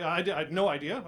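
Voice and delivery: ordinary talk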